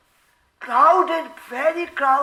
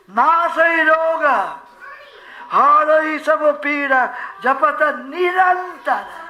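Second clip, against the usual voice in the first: loud, sustained Hindi chant; devotional verse recitation; 3 semitones higher, 6 dB louder than usual. Real, not acted. Preacher quotes the Hanuman Chalisa to devotees, chanted recitation. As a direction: intone the scripture verse with full voice.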